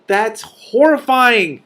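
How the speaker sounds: high, loud, slow exclamation; horrified. Real, not acted. Reader reacting to the buzzing cave, appalled. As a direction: cry out in horror.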